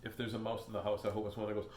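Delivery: usual speaking voice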